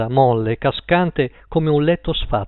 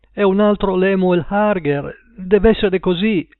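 higher-pitched, desperate insistence; 5 semitones higher, 3 dB louder than usual. A narrator's reading. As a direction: plead it urgently, voice raised in pitch. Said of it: "disse l'uomo disperato". (insistenza disperata, voce più acuta)

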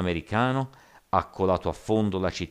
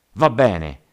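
loud, raised-pitch shout; 5 semitones higher, 11 dB louder than usual. A narrator's reading. Zhao cutting off the rioters, shouting. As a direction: shout it over the crowd. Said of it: "gridò Zhao".

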